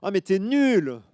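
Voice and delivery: put-on high, loud childish taunt; loud high you're useless; annoyed little girl. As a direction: snap it like a cross little girl. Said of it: "elle commence à s'énerver".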